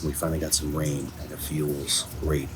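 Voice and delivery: ordinary delivery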